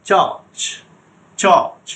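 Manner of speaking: চার্চ said slowly and clearly as church; pronunciation demo. Shown as a demonstration. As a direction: model the word চার্চ for the class. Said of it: "এটার উচ্চারণ".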